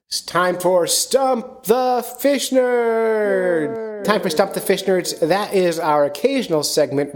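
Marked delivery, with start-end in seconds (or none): 0.1-4.0 drawn-out, high showman call; announcer voice. Acted. Host kicking off the call-in segment, big announcer voice. 4.0-5.2 higher, punchy segment intro; announcer voice. Acted. Host repeating the segment name, punchy announcer read.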